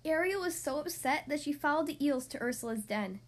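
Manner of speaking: ordinary delivery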